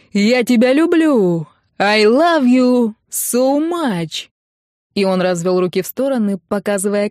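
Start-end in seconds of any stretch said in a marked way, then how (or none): 0.1-4.3 higher, slow drawn-out silly declaration; goofy yell. A narrator's reading. Groom declaring love up at the window, goofy and drawn out. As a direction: stretch every word in a clownish love call. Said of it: "орал Келла дурашливым голосом".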